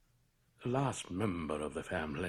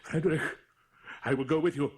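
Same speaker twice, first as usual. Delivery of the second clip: louder, higher insistence; urgent plea; 8 semitones higher, 9 dB louder, much faster than usual. Acted. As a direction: burst in eagerly to go along. Friend insists on joining the hunt.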